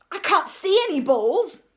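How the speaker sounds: loud, high, exasperated; frantic shout. A narrator's reading. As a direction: yell in baffled frustration.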